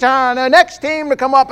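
high, loud, booming announcer voice; mock stadium hype call; arena announcer.

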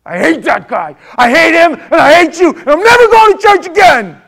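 high, loud tantrum; furious outburst. Acted. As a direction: rant like someone storming out for good. Acts out hard-hearted churchgoer's outburst.

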